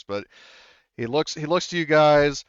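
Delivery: ordinary talk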